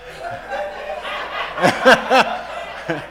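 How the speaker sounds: a long amused laugh at his own joke. Real, not acted.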